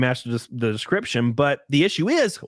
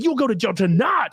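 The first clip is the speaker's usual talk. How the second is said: higher, shouted threat; imitating cop's shout; 6 semitones higher than usual. Cop shouting jail threat at teen, mock shout. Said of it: "He's screaming at me".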